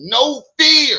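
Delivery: high, loud imitation of screaming; big high-pitched mimicked yell; mock scream. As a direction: holler it like a panicked hero, mockingly. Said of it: "and starts screaming".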